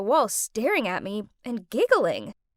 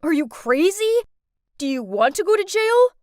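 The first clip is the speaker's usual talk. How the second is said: alarmed outburst; 5 semitones higher, 5 dB louder than usual. Acted. Boyfriend alarmed at confession.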